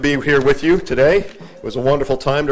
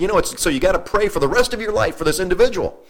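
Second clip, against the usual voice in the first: higher, earnest mock officiant; imitating officiant; 6 semitones higher than usual. Preacher imitates funeral officiant telling mourners to pray, higher. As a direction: echo the officiant's plea.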